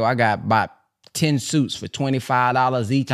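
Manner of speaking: ordinary delivery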